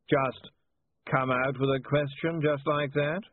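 slow it down, thoroughly baffled. A narrator's reading.

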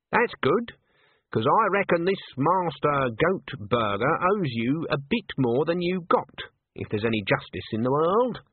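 measured and pointed, spelling it out; self-assured granny voice; Granny's dialogue. Acted.

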